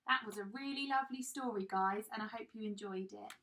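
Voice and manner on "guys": plain reading voice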